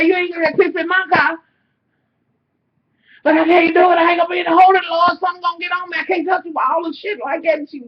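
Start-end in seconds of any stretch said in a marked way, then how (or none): 0.0-1.4 mock scolding mother. Mother scolding in retold scene. 3.2-7.6 desperate mock plea. Desperate pleading, retold bathroom.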